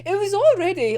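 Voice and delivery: ordinary delivery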